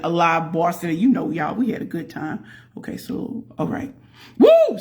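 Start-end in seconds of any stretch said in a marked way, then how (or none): 4.4-4.8 cheer. Real, not acted. Cheering on stream.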